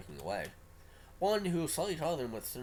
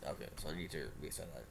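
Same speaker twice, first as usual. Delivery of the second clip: ordinary delivery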